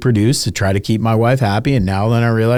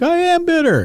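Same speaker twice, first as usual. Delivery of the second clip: mock crying wife.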